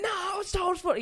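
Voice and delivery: surprised protest. Retold or imitated. Retold protest to the techie.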